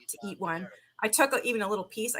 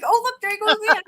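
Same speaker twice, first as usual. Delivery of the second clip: much higher, louder gush; cute high voice; 13 semitones higher, 8 dB louder, faster than usual. Retold or imitated. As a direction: excitedly point it out. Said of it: "I'm like".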